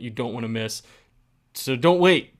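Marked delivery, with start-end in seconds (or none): 1.5-2.4 emphatic urging. Real, not acted. Urging listeners to buy tickets.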